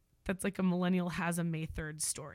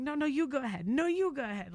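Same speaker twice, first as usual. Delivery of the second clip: higher-pitched mock courtesy; imitating over-polite drivers, pitched up; insistent politeness; 7 semitones higher, 4 dB louder than usual. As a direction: insist the other driver goes first. Acting overly polite drivers deferring.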